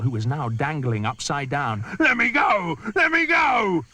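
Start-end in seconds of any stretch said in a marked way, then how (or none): 2.0-3.8 desperate crocodile. Acted. Crocodile dangled upside down.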